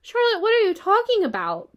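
high, loud, disbelieving question; alarmed cry. A narrator's reading. Wilbur questioning Charlotte about not going home, alarmed cry. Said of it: "he cried".

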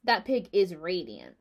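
slow, self-satisfied verdict; well pleased. A narrator's reading. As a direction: savour it slowly, pleased with yourself. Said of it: "well pleased with himself".

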